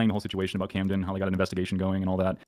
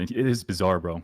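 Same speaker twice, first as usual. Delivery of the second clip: ordinary talk, nothing marked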